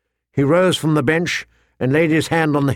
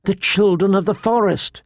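put-on high, eager child's insistence; blurted; 7 semitones higher than usual. A narrator's reading. Boy explaining who will save his uncle, eager. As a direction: pipe up high with sudden hope. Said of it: "he blurted".

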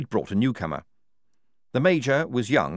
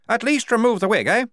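high, loud, bluff officer's voice; booming jolly commander; hearty major; 13 semitones higher, 6 dB louder than usual. A narrator's reading. The major greeting the squad, loud and bluff.

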